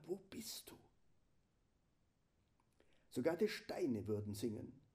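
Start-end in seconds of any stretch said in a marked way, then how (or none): 0.1-0.8 unvoiced whisper, soft. Shown as a demonstration. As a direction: breathe the question without tone. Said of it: "Das Evangelium geflüstert".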